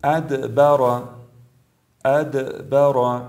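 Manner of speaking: recited ادبر with short echo on د; brief qalqala. Shown as a demonstration. Tajweed teacher shows letter dal, bouncing د in ادبر. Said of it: "مثال هایی هست حرف دال".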